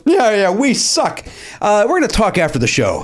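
lightly voiced irritated comeback. Rock star answering a heckler from the stage, mock sarcastic retort.

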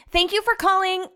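customer service voice. Shown as a demonstration. Call-center rep greeting a caller, bright customer service voice. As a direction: answer like a super friendly call agent. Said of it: "let me do it so you guys get the vibe".

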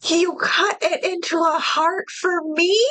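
high, mocking quote of Sarah. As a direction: sneer it out as the laughing lover. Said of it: "laughs at it".

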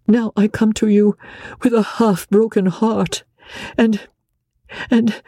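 a distressed wife begging her husband; Marguerite pleading. A narrator's reading.

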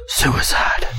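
breathy unvoiced whisper; a mock-ominous hushed whisper. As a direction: whisper it darkly under your breath. [name] mutters about pig's fate.

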